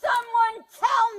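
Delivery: high desperate shout. Real, not acted. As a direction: yell out for help. Arrestee shouting for answers.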